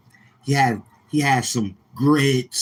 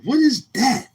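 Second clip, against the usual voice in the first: mock disbelief; 9 semitones higher than usual. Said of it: "I said".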